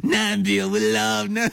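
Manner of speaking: high-pitched mock politician gushing "Nambia"; high, comic "Nambia" breaking towards laughter; Donald Trump. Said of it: "just gonna Donald Trump it".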